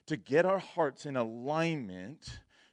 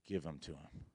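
hushed inner voice; 9 semitones lower, 14 dB quieter than usual. Retold or imitated. Sensing the Lord speak inwardly.

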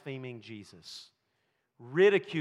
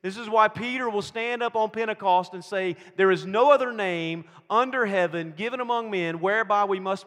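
loud, raised, emphatic sermon delivery; full-force preaching; 5 semitones higher, 9 dB louder than usual. Real, not acted. Preacher proclaiming the gospel to the congregation, loud and forceful.

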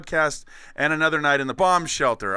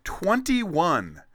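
grand announcer; 6 semitones higher, 3 dB louder, slower than usual. Pretend. Grand mock welcome.

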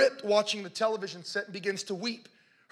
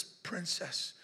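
tender; 2 semitones lower, 13 dB quieter than usual. Retold or imitated. Father reconciles with weeping daughter.

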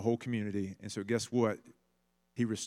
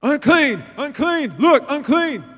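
high, loud shouted warning; calling out unclean; 14 dB louder, slower than usual. Retold or imitated. Crowd calls out to keep the woman back, loud warning shout. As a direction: cry out to keep her away. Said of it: "and there's".

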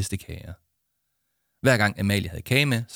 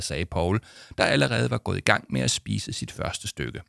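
ordinary delivery

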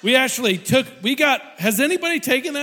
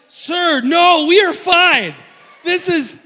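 raised voice; 6 semitones higher, 7 dB louder, slower than usual. Retold or imitated. Telling 911 operator all's fine.

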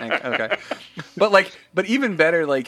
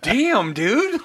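awed; 8 semitones higher than usual. Retold or imitated. Awed reaction.

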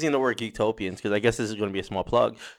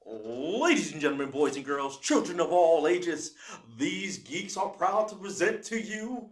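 ring announcer; 6 semitones higher than usual. Acted. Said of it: "can I go full steam?".

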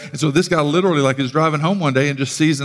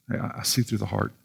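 low and much softer; quiet divine rebuke; 5 semitones lower, 10 dB quieter than usual. Retold or imitated. God gently answers the self-serving believer, hushed and low. Voicing God's reply.

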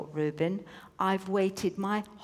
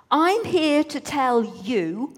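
slow announcement; 5 semitones higher, 5 dB louder, slower than usual. A narrator's reading. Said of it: "No, actually".

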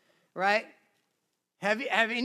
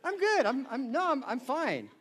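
pretending fine; 3 semitones higher than usual. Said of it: "You're like".

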